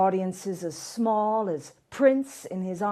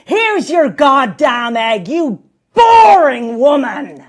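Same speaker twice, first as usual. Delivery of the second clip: exasperated outburst; 4 semitones higher, 14 dB louder than usual. Acted. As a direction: blow up at her, stretching the insult. Host snaps at egg owner.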